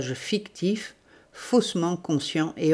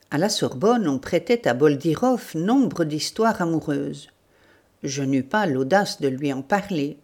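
plain reading voice

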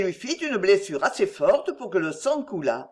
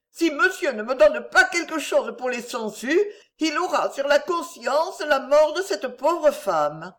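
insistent beggar woman; 7 semitones higher, 3 dB louder than usual. A narrator's reading. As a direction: plead accusingly in a high voice, slowing down.

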